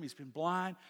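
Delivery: ordinary talk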